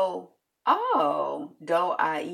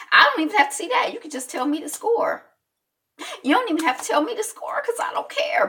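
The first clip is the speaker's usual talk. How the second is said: rising exasperation; 9 semitones higher, 3 dB louder than usual. Real, not acted. Escalating mock disinterest in sports.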